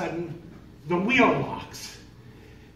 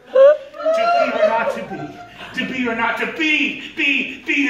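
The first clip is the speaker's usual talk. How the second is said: hammed-up Shakespeare; 10 semitones higher, 10 dB louder than usual. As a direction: declaim it like a ham actor. Panicking, quoting Hamlet theatrically.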